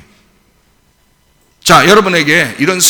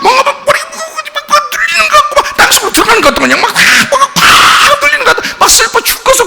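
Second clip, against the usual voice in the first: high, fast, laughing overwhelmed rant; hammed-up frantic complaining; mock panic; 3 dB louder than usual. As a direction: ham up the suffocating distress. Mocking people overwhelmed by trouble.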